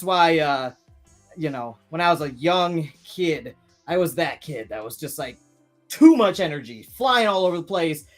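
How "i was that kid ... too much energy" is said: ordinary talk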